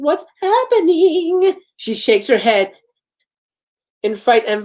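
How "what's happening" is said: very slow, high, thin plea; weak, frightened. Acted. Barbara asks the truck driver, weak, high and very slow. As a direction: ask it like a scared child. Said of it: "Barbara repeating".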